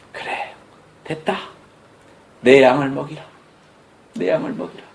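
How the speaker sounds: hushed, tender reply ending almost whispered; quietly entrust him with the sheep; softly spoken charge from Jesus; soft commission. Acted. Jesus answers Peter softly.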